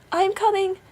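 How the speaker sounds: high, loud anxious reply; wife calling back. A narrator's reading. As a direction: call down to an impatient husband. Wife calls down to Bluebeard.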